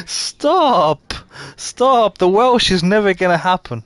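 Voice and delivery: high, exclaimed refusal; playful exasperated plea; exasperated protest. Real, not acted. Playful protest at accent request.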